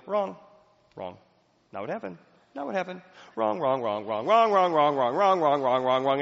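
rising, insistent repeated wrongs; a poor listener itching to interrupt; impatient inner objection. Retold or imitated. Listener silently objecting while someone shares feelings, mounting impatience. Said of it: "you're immediately going".

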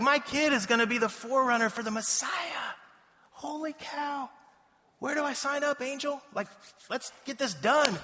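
high, elated, bragging new father; overjoyed and raring to go; excited Zacharias. Acted. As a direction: gush with hammed-up excitement, pitched well up. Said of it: "he would be so excited".

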